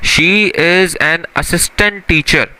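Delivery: slow, word-by-word She is an assistant teacher; slow example read. Shown as a demonstration. Teacher reading a new example sentence slowly. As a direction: read it out deliberately for the class. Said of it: "clear हो गई बात?".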